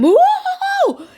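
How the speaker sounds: very high, loud yelp of fright; terrified high whoa with a laugh in it; frightened shout. Acted. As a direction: leap out of your skin with a yelp. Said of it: "he shouted in fright".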